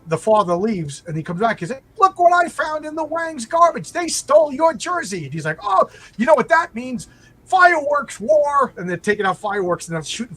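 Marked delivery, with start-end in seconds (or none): 2.0-5.2 high, loud gleeful discovery; dad shows the husband the stolen jersey, high and gleeful; goofy sitcom dad. Retold or imitated. Father returns with jersey. 5.6-8.7 high, loud, slowed on fireworks war; husband rallies everyone for a fireworks war, high and loud; gleeful sitcom husband. Retold or imitated.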